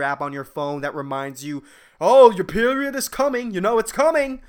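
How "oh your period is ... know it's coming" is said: higher and louder, teasing warning voice; ominous app reminder. Acted. The calendar app warning her period is coming, in a hammed-up voice. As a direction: announce it like a looming threat.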